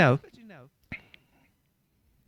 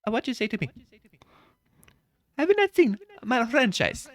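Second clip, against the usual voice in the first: higher mock Italian plumber; Mario voice; 13 semitones higher than usual. Mario defending his franchise, higher put-on voice. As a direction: puff up like offended Mario.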